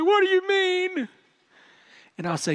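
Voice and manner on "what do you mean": put-on high, slow child's question; puzzled little girl, pitched way up; little daughter. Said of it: "She'll say".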